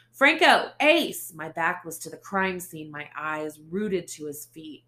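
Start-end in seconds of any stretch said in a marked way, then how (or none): 0.1-1.3 shouting names high and loud; loud desperate call to the detectives; yell. Acted. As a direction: call for help across the scene. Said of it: "I yelled".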